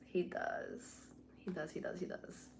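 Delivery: softly repeating he does until it disappears; fading whisper. Shown as a demonstration. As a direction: let he does fade away into a whisper. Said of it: "repeats it fading to a whisper".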